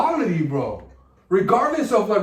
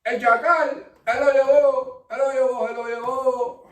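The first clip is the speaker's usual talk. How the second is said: higher-pitched Spanish game-show host; Don Francisco; 5 semitones higher than usual. Shown as a demonstration. Comic retrying the impression in Spanish, higher emphatic host voice. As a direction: announce like a TV host, raised and emphatic. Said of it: "work with my Don Francisco".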